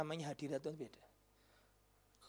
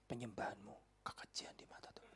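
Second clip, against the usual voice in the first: gentle whisper; 8 semitones lower, 15 dB quieter than usual. Shown as a demonstration. Pastor gently telling the girl her worship, in a whisper. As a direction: whisper "Penyembahanmu kekejian" softly and low. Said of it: "mencari kata-kata yang halus".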